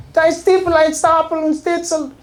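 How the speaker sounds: high, hammed-up fake German; mock German gibberish. Guard answering the American in fake German gibberish. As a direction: spit out nonsense German like a cartoon guard. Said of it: "he'd be like".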